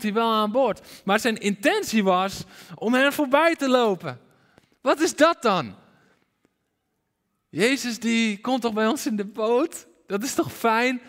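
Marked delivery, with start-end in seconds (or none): 4.8-5.7 high, incredulous question; higher-pitched, astonished challenge; puzzled disbelief. Acted. Baffled reaction to Jesus passing by. 7.5-11.0 higher-pitched, protesting question; puzzled, expectant questioning; bewildered objection. Acted. Acts bewildered objection to the verse.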